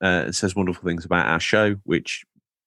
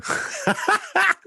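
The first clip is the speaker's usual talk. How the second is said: high burst of laughter. Real, not acted. Laughing at blood joke.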